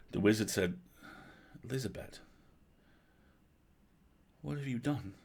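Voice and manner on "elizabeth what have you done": soft, higher-pitched hushed question; hushed dismay. A narrator's reading. Wizard questioning the girl, hushed. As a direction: ask it softly, pitch raised.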